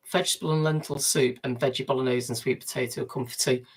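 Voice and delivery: usual speaking voice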